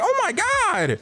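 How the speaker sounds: high-pitched comic disbelief; shocked reaction. Retold or imitated. Viewer reacting to what she woke up to, high shock. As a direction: exclaim it high, scandalised.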